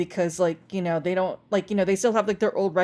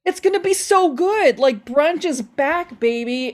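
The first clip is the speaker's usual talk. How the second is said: high, louder, emphatic hype; real excitement; 6 semitones higher, 5 dB louder than usual. Real, not acted. Telling the host brunch is back, really excited. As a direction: hype it up like a big comeback.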